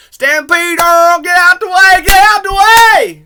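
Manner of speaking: screaming high and very loud, slow; Tremors impression. Mimicking a Tremors character screaming at Earl. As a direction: yell it like a panicked character in the film. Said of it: "do Tremors impressions all day".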